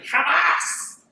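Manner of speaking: high, loud outburst of anger; imitated ire. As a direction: imitate a furious old woman refusing. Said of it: "con una ira".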